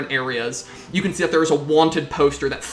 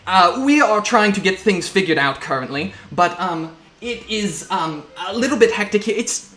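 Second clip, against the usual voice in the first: flustered officer, raised pitch, stumbling through excuses; Captain Lawrence, harried; 4 semitones higher, 3 dB louder than usual. Acted. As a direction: play a rattled captain juggling chaos mid-call. Game master plays harried Captain.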